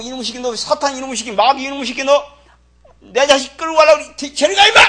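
God yelling at Satan to get away; angry shout of get away; scolding God. Pretend. God chasing off Satan, angry shout.

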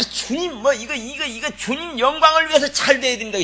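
high, strained cry to the Lord; hammed-up wailing plea; mock pleading. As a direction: plead loudly in an exaggerated high voice. Mimics self-serving plea to the Lord.

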